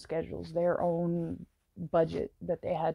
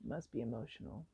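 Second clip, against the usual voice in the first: tearful and hushed, voice breaking; fighting back real tears mid-sentence; softly crying while talking; 3 semitones higher, 5 dB quieter than usual. Real, not acted. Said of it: "I don't know why I'm crying right now".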